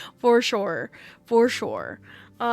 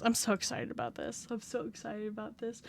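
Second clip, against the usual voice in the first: soft excitement; 2 semitones lower, 13 dB quieter than usual. Real, not acted.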